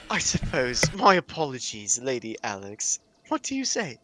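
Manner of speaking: put-on posh accent. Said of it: "give us a posh accent".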